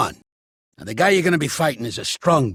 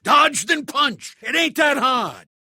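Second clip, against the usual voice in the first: impatient shout; 11 semitones higher, slower than usual. Real, not acted. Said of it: "You deaf?".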